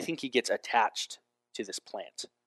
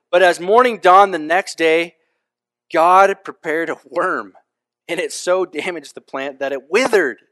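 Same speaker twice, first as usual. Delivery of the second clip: dramatic scripture reading; 4 semitones higher, 9 dB louder than usual. Acted. Preacher reads Jonah chapter four to the congregation, loud and proclaiming. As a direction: read it like a herald proclaiming the word.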